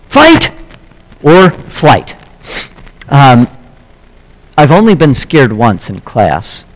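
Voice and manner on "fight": higher, gasping mock fright; gasp, then blurt fight. Shown as a demonstration. Preacher showing fear response, gasping mock fright. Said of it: "and then gasps".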